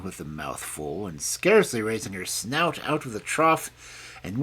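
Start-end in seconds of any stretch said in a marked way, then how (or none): none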